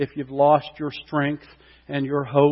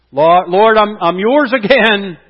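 loud, higher cry of surrender; fervent prayer; 7 semitones higher, 10 dB louder than usual. Acted. Preacher voicing a believer crying back to God, loud and fervent. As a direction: call out to the Lord as if coming home.

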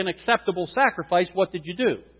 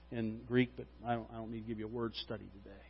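much softer, fast throwaway aside; quiet aside; 6 semitones lower, 15 dB quieter, faster than usual. Real, not acted. Pastor skipping the word study, quick soft aside. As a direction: mumble it off to the side.

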